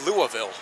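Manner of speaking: "Louisville" as LOO-uh-vul, falling and matter-of-fact; local pronunciation of Louisville. Shown as a demonstration. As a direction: blur the middle of "Louisville" to a quick "uh". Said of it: "Yes, it's pronounced".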